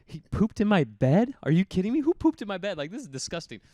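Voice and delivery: high, loud, incredulous yelling; outraged disbelief. Retold or imitated. Waking in a soiled bed, yelling in outraged disbelief. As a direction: act out waking up furious at a mystery mess. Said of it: "My first thought was".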